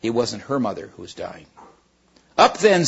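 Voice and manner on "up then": higher, louder call; brisk call to go; urgent. Acted. Diggory hurries Polly onto the horse, urgent call.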